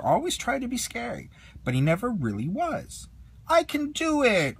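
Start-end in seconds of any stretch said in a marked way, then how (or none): 3.5-4.6 much higher, slow pleading dino voice; insisting. Acted. Toy dinosaur insisting to teammates, high insistent voice. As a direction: insist like an unsure little dinosaur. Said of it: "Rex insisted".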